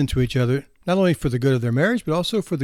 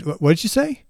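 mock hard of hearing; 7 semitones higher than usual. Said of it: "And he said".

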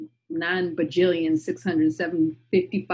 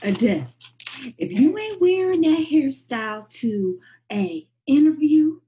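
imitating a commentator, pitched up and a bit louder; mock lecturing voice; 7 semitones higher, 3 dB louder than usual. Quoting Yaba's lecture to Adele, mock scolding. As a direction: lay down the rule like a scolding pundit. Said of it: "she's like".

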